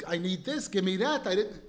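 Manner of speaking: loud, demanding, rude; like a hooligan. Retold or imitated. Father hears his son as a rude hooligan, loud and demanding. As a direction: toss off the demands like a spoiled hooligan. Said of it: "he sounds like a, like a hooligan".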